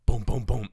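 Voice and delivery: loud spoken knocking; mock door knocks. Police at the hotel room door, loud mock knocking. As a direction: pound out the knocks with your voice.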